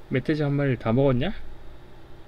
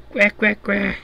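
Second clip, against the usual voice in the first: 높게 꽥꽥거리는 돼지 소리; 돼지처럼 날카롭게 꽥꽥; 7 semitones higher, 4 dB louder, slower than usual. Acted. (높은 돼지 울음 흉내)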